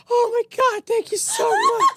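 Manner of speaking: overjoyed relief, squealed out; relieved gushing. Retold or imitated. Head lady thanking the kid after finding him, squealing with relief. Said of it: "the head lady's like".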